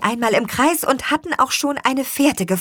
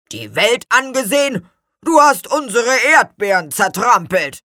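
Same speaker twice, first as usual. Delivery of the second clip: loud, rumbling reproach; grumbling; 4 semitones higher, 6 dB louder than usual. A narrator's reading. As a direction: grumble it as an accusation. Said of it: "grollte Gurkenhut". (grollend und laut vorwurfsvoll)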